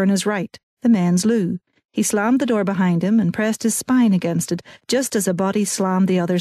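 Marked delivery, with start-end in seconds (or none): none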